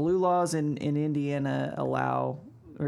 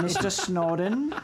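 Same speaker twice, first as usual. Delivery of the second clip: slow mock Russian accent; slower than usual. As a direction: drawl the name like a Russian agent. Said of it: "Is that your Russian voice?".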